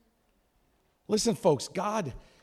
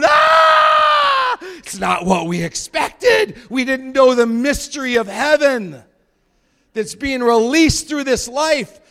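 loud high shriek, then loud panicked words; terrified demons; 10 semitones higher, 12 dB louder, slower than usual. Retold or imitated. Demons panicking at the light of heaven, in a loud high terrified shout. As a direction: scream like a cornered demon. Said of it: "the demons are going".

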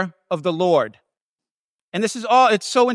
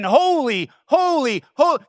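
Holy, holy, holy climbing higher each time and declared; giant exclamation; 5 semitones higher than usual. Shown as a demonstration. Angel shouting praise in God's presence, climbing exclamation. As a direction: build each Holy into an awestruck cry. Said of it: "one giant exclamation at his top of his lungs".

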